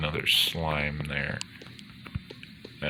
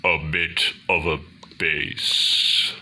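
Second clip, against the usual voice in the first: slow, deliberate bass delivery; 2 semitones lower than usual. Shown as a demonstration. Speaker showing listeners his bass range, slow bass voice. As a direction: draw it out slowly in a bass voice. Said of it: "my voice is".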